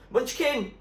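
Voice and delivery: high shout over the crashing water. A narrator's reading. Zoe yells to Munchkin over the waves, loud shout. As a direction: yell his name over the sea. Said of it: "shouted Zoe above the water".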